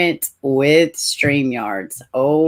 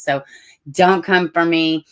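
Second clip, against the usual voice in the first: ordinary talk, nothing marked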